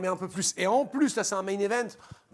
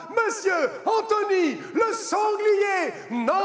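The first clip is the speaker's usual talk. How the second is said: ring announcer; 8 semitones higher, 4 dB louder, slower than usual. Acted. Presenter introduces the guest.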